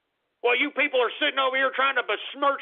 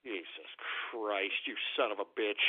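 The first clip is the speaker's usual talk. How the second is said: disgusted mutter; 13 semitones lower, 12 dB quieter than usual. Real, not acted. Host reacts to prank call.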